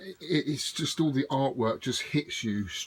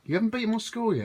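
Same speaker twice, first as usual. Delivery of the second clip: mock disbelief; 9 semitones higher, faster than usual. Mock shock at friend's score.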